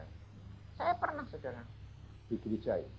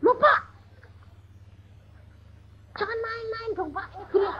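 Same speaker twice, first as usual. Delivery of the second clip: alarmed pastor; faster than usual. Retold or imitated. Pastor panicking at the preacher before the service, high alarmed plea. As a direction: sound panicked that the speaker has nothing ready.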